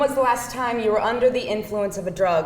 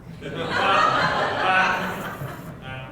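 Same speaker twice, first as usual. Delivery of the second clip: amused laugh; 3 semitones lower than usual. Acted. Laugh at the reply.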